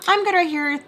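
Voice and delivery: breezy refusal. Retold or imitated. Daughter turning down her infuriated father, breezily defiant. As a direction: mimic a defiant daughter, pitched right up.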